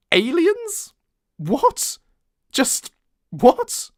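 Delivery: disbelief. Acted. Narrator reacts in disbelief.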